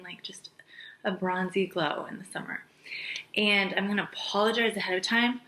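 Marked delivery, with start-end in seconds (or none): 0.0-2.6 whispering. Real, not acted. Gushing about summer bronzy glow.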